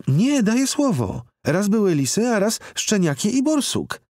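put-on very high, eager child voice; insistent little girl. A narrator's reading. Girl insists to the doubting man, in a high eager voice. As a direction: swear it like a child who wants to be believed.

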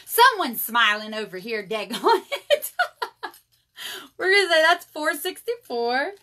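laughing through the words, loud; real laughter. Real, not acted. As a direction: talk through a fit of laughs.

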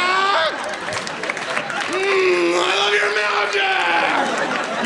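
high, screaming laughing-crying joy at magic; hysterical shriek. Retold or imitated. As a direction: lose it completely with involuntary glee. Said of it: "people would just be like".

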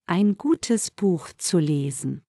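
ordinary talk, nothing marked

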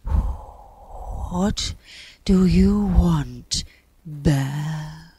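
slow, airy, blowing voice; sighing wind. A narrator's reading. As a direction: breathe out each word like a gust. Wind's character voice.

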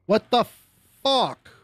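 sudden high outburst of frustration; explosive rage. Real, not acted. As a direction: snap at the game in disbelief. Game fails, frustrated outburst.

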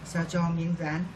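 normal talking voice

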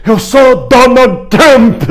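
high, very slow rallying cry; imitated protest shout. Man recalling this week's yell at Paulista, mock shouting. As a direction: stretch each word like a slogan. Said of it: "fui pra Paulista e gritei".

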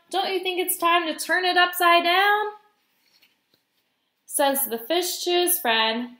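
singsong picture-book narration; reading to children. A narrator's reading. Guest reader telling of clam and gloomy fish, lilting storytime read. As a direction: lift the animals' lines in a lively rhyme.

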